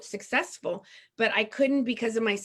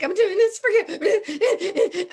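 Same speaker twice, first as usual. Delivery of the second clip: laughing through tears; 10 semitones higher, 7 dB louder, much slower than usual. Real, not acted. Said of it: "I'm just coming undone".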